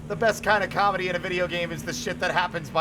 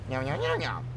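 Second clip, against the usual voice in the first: soft mewing meows; quiet cartoon cat; 5 semitones lower, 4 dB quieter than usual. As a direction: meow like a cat. Cat Nigel voiced.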